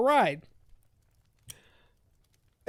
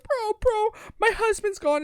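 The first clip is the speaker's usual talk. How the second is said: much higher, squealing mock panic; 12 semitones higher than usual. Heroine panicking to her sister, high mock panic. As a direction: squeak it out like a frantic wife. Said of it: "having a panic attack".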